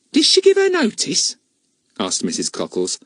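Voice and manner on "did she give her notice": much higher, louder country gossip; a cockney charwoman gossiping, higher and louder; cleaning woman's voice. Acted. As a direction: warn her knowingly. Mrs Cockles asking.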